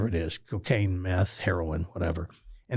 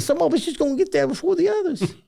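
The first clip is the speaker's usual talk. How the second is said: put-on fast, high, chirpy old man; imitating Reggie; faster than usual. Reggie shrugs off death to the host, fast and high. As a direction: toss it off lightly and quickly.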